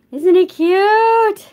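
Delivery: high, loud, drawn-out cooing; sing-song gush of adoration. Real, not acted. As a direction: coo over something adorable, drawing it out. Cooing over her gnome.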